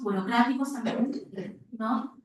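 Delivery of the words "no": ordinary delivery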